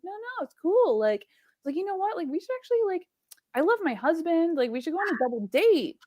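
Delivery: high-pitched, breezy imitation of a teasing message; playful mock enthusiasm. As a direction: retell it brightly, pitched well up, egging them on. Retelling her teasing reply to a match.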